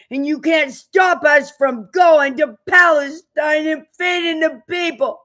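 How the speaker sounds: loud, high-pitched furious yell; shouting defiance about Palestine; defiant shout. Real, not acted. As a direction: dare them to stop you.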